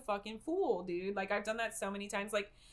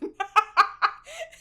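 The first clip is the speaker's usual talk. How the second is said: a sudden high burst of laughter; loud laugh; 12 semitones higher, 11 dB louder than usual. Real, not acted. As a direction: crack up loudly.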